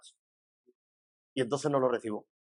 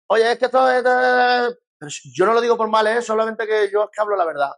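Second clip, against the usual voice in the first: higher, louder, faster mock of a smug gossip; mocking self-righteous gossip; 7 semitones higher, 5 dB louder than usual. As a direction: ham up the hypocrite's defensive excuse. Hypocrite insists, mocking.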